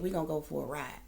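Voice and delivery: low, soft imitation of the officer; mock officer voice. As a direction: drop low and quiet like the cop. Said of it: "that's what that man said".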